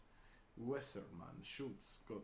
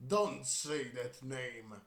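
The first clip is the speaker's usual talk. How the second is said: angry outburst; 4 semitones higher, 11 dB louder, much slower than usual. Acted. Character snaps at forbidden name.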